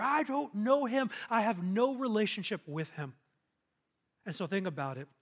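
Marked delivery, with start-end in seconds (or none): 0.0-3.1 emphatic, raised-pitch disowning; acted-out firm denial; vehement denial. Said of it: "He denies it so vehemently".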